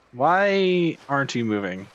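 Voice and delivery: normal talking voice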